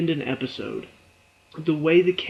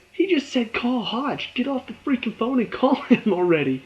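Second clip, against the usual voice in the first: exasperated rant; 8 semitones higher than usual. Retold or imitated. Vlogger venting at Savannah on screen, exasperated and high. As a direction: scold the character, pitched up. Frustrated at character.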